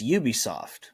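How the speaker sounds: "Ubisoft" with an opening "you" sound; Ubisoft said YOO-bee-soft. Shown as a demonstration. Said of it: "is it Ubisoft or Ubisoft".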